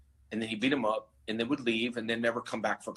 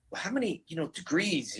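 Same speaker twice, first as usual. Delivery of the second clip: much higher, mock-amazed question; surprised asker; 6 semitones higher than usual. People asking about his degrees, in a mock-amazed voice. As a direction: play the impressed people asking. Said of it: "they're like".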